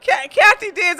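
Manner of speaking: high, loud excited call of a name; excited greeting. Real, not acted. Excited greeting to chat viewer.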